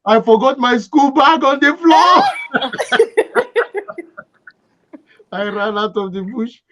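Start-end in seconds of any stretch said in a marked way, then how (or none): 2.3-4.2 high burst of laughter; laughing. Real, not acted. Laughing at own memory, high laugh.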